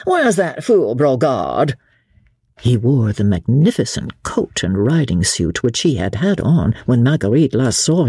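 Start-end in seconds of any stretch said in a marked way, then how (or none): 0.0-1.7 hearty call, higher pitched; loud cheerful call. Acted. Percy calling out as he enters the inn, loud and cheerful. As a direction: call out cheerfully, slowing at the end. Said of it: "he called in a loud, cheerful voice".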